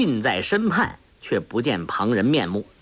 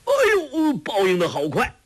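yelp; 11 semitones higher than usual. Acted. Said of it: "叫声".

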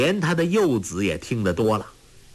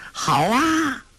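put-on much higher, delighted child's shout; gleeful cheer; 12 semitones higher than usual. A narrator's reading. Tolui cheers as the bully falls, gleeful. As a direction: clap and cheer. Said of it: "托雷拍手笑道".

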